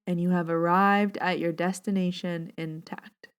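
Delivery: soft, calm meditation voice; unhurried, soothing reading voice; guided meditation. Acted. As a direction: guide listeners gently into their breath.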